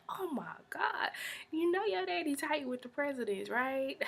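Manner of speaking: high-pitched sarcastic jab; mocking retort. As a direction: tease him, pitch up and laugh. Speaker reacts to the bragging.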